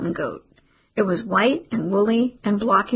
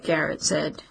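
ordinary delivery, nothing marked

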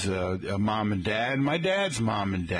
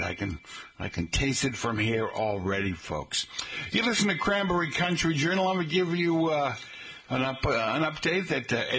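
normal talking voice

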